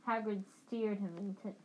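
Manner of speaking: plain reading voice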